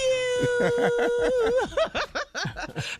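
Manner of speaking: big helpless cackle; long cackling laugh. Real, not acted. Host cracking up at the nephew's joke, long loud laugh.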